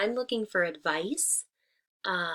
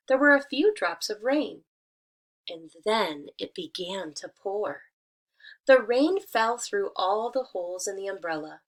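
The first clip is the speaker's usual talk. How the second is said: lively, louder storybook reading; reading to children; 6 dB louder than usual. Acted. Teacher reading a fable to her students, lively storytelling. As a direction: read it out brightly for the class.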